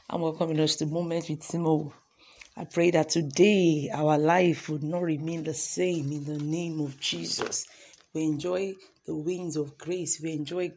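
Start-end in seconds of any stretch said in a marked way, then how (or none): 2.5-7.6 prayer. Acted. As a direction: pray it slowly and gently.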